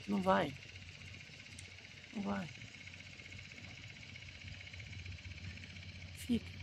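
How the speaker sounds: soft, hushed and pained, nearly breaking; hushed pleading to a departing stepmother; quiet sad plea. Shown as a demonstration. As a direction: beg her quietly to stay, holding back tears. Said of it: "Vou ficar triste".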